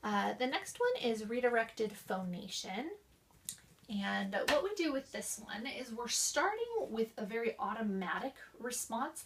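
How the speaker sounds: normal talking voice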